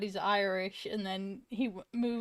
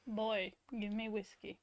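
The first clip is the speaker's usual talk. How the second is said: Kratos; 10 dB quieter than usual. Acted. Host playing Kratos demanding whiskey, quiet impression. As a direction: softly order a drink as an imitated warrior. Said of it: "doing the impressions of".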